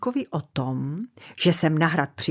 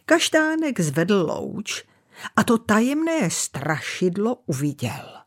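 ordinary delivery, nothing marked

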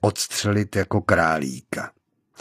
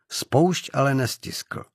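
usual speaking voice

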